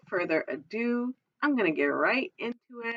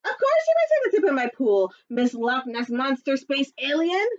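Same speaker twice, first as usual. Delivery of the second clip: lifeguard voice; 3 semitones higher than usual. Acted. Lifeguard welcomes Octorino, high and cheerful. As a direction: welcome brightly then plead.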